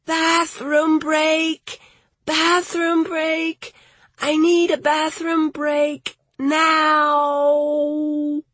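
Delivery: high, drawn-out desperate yelling; urgent shout. A narrator's reading. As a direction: wail it out, desperate for the toilet. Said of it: "Patricia shouted".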